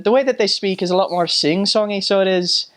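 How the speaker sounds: high, lilting Irish brogue; imitating Irish intonation; sing-songy Irish accent. Shown as a demonstration. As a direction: bounce up and down like the Irish. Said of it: "sing-songy, uh, if I were to put it, their intonations".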